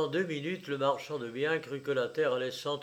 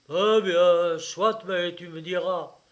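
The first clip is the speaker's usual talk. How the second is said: grudging concession; 3 semitones higher, 10 dB louder than usual. A narrator's reading. Father grudgingly yields, loudly.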